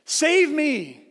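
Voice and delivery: high-pitched mock yell for rescue; yelling for help. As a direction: call out to the lifeguard as if stranded. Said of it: "you're yelling at him".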